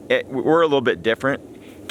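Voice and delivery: ordinary delivery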